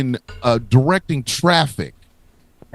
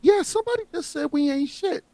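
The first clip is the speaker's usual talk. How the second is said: high, hushed state voice; 12 semitones higher, 6 dB quieter than usual. Pretend.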